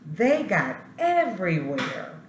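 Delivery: ordinary delivery, nothing marked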